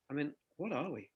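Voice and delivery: ordinary talk, nothing marked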